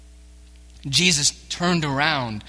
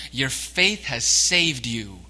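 "Your faith has saved you" drawn out slowly, "faith" leaned on; slow emphatic; 4 dB louder, slower than usual. Acted. Jesus telling the woman, slow and firm. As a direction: lay it out slowly and firmly. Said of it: "Jesus is saying to her".

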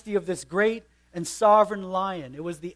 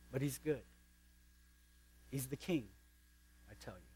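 much softer and lower; hushed reverence; 5 semitones lower, 15 dB quieter, faster than usual. Acted. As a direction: drop to an awed hush. Beaver's reverent close.

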